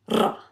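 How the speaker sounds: R trilled with the tongue tip; R rolled fast. Shown as a demonstration. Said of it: "The R's are rolled in Arabic".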